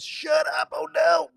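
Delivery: sudden, high, loud yelp of disbelief; shocked shout. Real, not acted. Streamer yelling at a wild chat message, shocked shout. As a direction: blurt it out like the chat just floored you.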